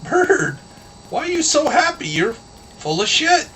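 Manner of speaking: high-pitched nosy animal voice; wolf in a fable. Acted. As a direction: question the bird in a cartoonish high voice. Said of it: "a wolf comes by and says".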